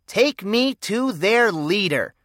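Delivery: slow, mock-commanding order. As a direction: give the order like a space commander. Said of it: "commanded Encyclopedia".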